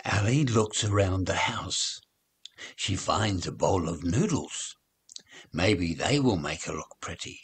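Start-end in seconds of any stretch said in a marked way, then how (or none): none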